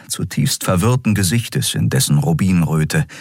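usual speaking voice

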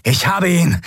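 high, called-out announcement; triumphant proclamation. A narrator's reading. Captor holding the admiral at gunpoint, high proclaiming call. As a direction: announce the catch to your comrades. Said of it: "verkündete der Draxyl".

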